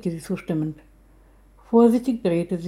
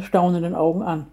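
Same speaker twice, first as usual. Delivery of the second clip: ordinary delivery, nothing marked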